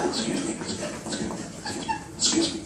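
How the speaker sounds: meek and quiet, rising to a high squeak; nervous fan whispering then squeaking; timid excuse me. Retold or imitated. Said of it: "I walk over to Matt Hasselbeck and I go".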